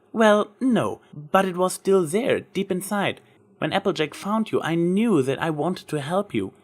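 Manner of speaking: lightly voiced Twilight, admiring; Twilight's voice. A narrator's reading.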